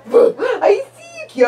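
loud high squeal; excited squeal. Real, not acted. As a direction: burst out with delight. Peekaboo reveal.

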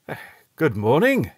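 ordinary delivery, nothing marked

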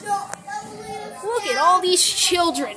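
ordinary talk

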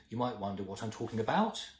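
question-like upswing on "about"; statement turned question, "about" lifted sharply; rising at the end. Shown as a demonstration. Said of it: "if I go up at the end".

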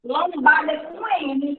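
normal talking voice

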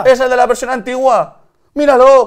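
high, loud comic shouting; mock outrage. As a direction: shout it in hammed-up disgust. Mock outrage at old app version. (gritos agudos de indignación fingida)

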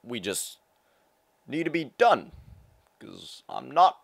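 high, loud, halting statesman voice; former president impression. Former president talking to Michelle, loud halting impression. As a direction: stretch words and pause between phrases, loudly. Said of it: "I have seventeen impressions for you".

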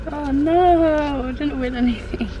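usual speaking voice

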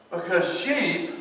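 ordinary talk, nothing marked

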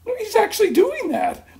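delighted disbelief bursting out with a laugh; laughing amazement. Retold or imitated. Retold amazed inner thought.

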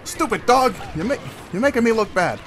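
mock a friend with an over-the-top Italian accent. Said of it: "trying to go with some weird Italian accent".

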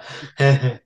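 short burst of giggling; giggle. Real, not acted. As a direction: break into giggles. Cracking up mid sign-off.

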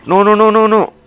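high, loud panicked cries; startled shouting as danger hits; real panic. Real, not acted. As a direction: yell out in sudden alarm. Sudden attack in the game.

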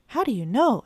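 a second kitten asking, eagerly. A narrator's reading. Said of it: "asked Tot".

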